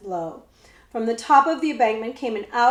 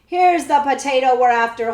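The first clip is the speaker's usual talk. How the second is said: gleeful roar; 3 semitones higher, 9 dB louder than usual. A narrator's reading. Outlaw catches the hidden boy and roars gleefully. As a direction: roar it with glee. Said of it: "roared gleefully".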